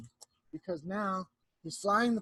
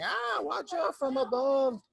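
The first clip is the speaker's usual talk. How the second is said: higher, slow little gosling voice; baby goslings calling; 7 semitones higher, slower than usual. Acted. Goslings warn from below, small high slow voice. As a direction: cry a warning like tiny goslings. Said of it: "are running around saying".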